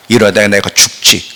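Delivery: normal talking voice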